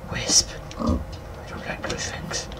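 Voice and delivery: whisper. Real, not acted. Wary of dangerous mobs.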